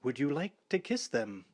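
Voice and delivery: a lightly voiced wicked invitation. A narrator's reading. Film vampire luring her lover, a touch of wicked. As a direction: tease with mock menace. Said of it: "Then wickedly".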